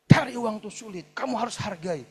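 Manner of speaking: imitating an exasperated dad; acted fatherly scolding. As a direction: lecture the child with a burst of force. Acts father lecturing about money.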